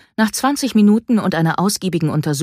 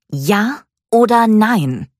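slow, higher, louder demand; combative; 7 semitones higher, 7 dB louder, slower than usual. A narrator's reading. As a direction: pin him down, spoiling for a fight. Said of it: "Mika sah den Tierarzt angriffslustig an".

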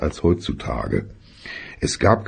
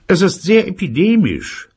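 father voice; 5 dB louder than usual. Acted.

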